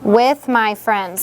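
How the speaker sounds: With my friends drawn out very slowly, leaning on "With"; slow emphasis. Shown as a demonstration. Teacher supplies the word the child skipped, slow and stressed. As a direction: stretch out "With" slowly so the child hears the missing word. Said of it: "Play with".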